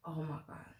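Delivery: whispered to herself in disbelief; stunned whisper. Retold or imitated. Whispering to herself after the crush turns her down. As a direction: breathe it out, barely voiced.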